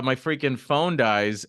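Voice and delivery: normal talking voice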